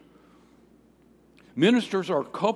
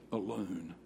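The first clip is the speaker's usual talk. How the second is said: usual speaking voice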